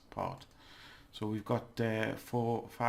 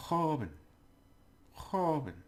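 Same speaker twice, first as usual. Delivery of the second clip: joven with a higher, falling "kho"; stress on first syllable; 5 semitones higher than usual. Shown as a demonstration. Teacher drilling the word, stressed first syllable. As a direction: lift the opening of joven, throaty J. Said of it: "push it up".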